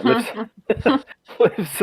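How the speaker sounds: words breaking into giggles; laughing. Real, not acted. GM losing it while narrating, laughing. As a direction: laugh through the word lifts. GM cracks up mid-sentence.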